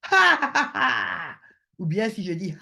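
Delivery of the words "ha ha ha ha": high hammed-up ha ha laugh; a put-on burst of laughter; mock laugh. Shown as a demonstration. Teacher demonstrates a laugh as pure sound, mock laughing.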